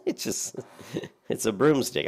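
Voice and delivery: amused, high and loud; laughing. Real, not acted. Laughing at the staff.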